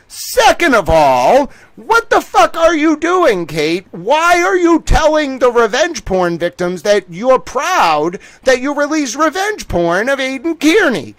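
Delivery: high, slow, incredulous scolding; outraged scolding. Retold or imitated. Scolding Kate for bragging to victims, outraged and incredulous. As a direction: demand answers in disbelief.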